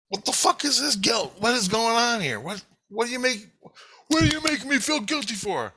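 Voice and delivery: high-pitched, exasperated self-interrogation; retold yelling at himself. Kid stops walking to question his own chest, mock yelling. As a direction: demand answers from your own conscience, voice pitched high. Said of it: "I'm yelling at myself".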